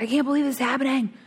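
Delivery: high, fast imitation of a panicking sponsor; mock panic. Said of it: "She's in a panic".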